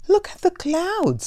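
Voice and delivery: high, bright call pointing up at the sky; excited call. Acted. Elmer calls eagerly to the hurrying hippos.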